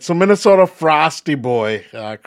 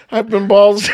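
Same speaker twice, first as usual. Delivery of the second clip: trying to read through laughter; cracking up; 4 semitones higher than usual. Real, not acted. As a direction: fight the giggles and lose.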